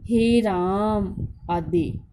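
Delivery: हे राम with the vowels stretched slowly; drawn-out calling vowel. Shown as a demonstration. Teacher showing pupils how a pluta vowel calls someone, slowly stretched. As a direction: call हे राम out long as if to someone far off. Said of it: "किसी को दूर से बुलाने के लिए".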